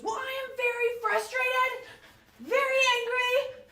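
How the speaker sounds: loud, high, slow mock-furious child; drawn-out high shout, playing an enraged daughter; angry daughter. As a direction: imitate a mad kid announcing each word at full volume. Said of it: "my daughter was real mad".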